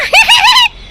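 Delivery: a big high shrieking laugh; high squealing laugh. Shown as a demonstration. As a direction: laugh out loud the way she always does. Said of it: "जब मैं ज़्यादा हंसती ना". (ज़ोर की ऊँची हँसी)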